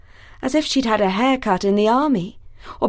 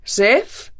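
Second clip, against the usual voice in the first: a raised call for Sif. Acted. Thor calls for his missing wife. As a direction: call Sif into the empty house. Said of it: "he called".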